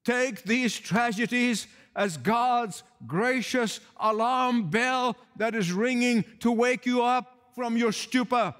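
ordinary delivery

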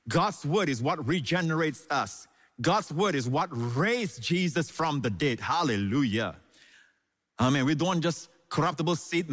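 normal talking voice

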